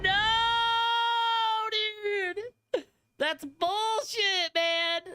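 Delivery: high, slow outraged cry; outraged whine. Real, not acted. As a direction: wail it in disbelief. Outraged after dying.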